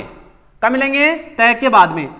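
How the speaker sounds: ordinary talk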